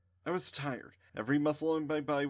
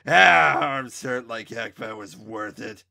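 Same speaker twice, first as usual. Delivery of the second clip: wincing in pain; 14 dB louder than usual. A narrator's reading. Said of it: "wincing in pain".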